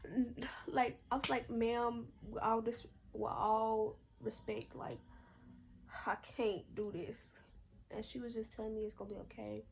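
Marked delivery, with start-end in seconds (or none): 1.5-7.3 louder, drawn-out polite refusal; with all respect, I can't do this, slowed and pressed; slow, firm plea. Retold or imitated. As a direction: spell it out slowly with respect, holding back tears. Pleading firmly with the nurse.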